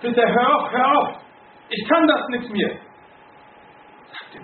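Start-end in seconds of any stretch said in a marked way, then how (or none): 0.0-2.8 high, loud desperate cry to stop; pleading scream. Retold or imitated. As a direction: beg at the top of the voice to make it stop. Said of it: "schreit sie so laut".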